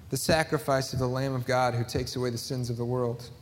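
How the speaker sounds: ordinary delivery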